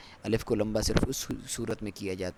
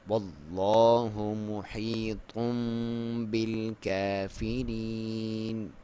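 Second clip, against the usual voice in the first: slow drawn-out tajweed chant; Quran recitation; much slower than usual. Shown as a demonstration. Teacher recites the verse for students, slow chant. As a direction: recite it slowly with full tajweed. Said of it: "کاف کے اوپر باریک پڑھیں گے اسے".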